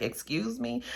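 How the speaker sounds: who do you think you are; offended surprise. Retold or imitated. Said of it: "He was like".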